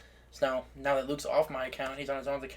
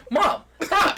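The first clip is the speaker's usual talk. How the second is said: high, loud plea to mom; laughing, embarrassed outcry; mock embarrassed protest; 8 dB louder than usual. Son protests in retold story.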